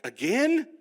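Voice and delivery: high, loud indignant "again"; exasperated. Retold or imitated. Pastor answering God, high loud disbelief. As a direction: whine it in disbelief.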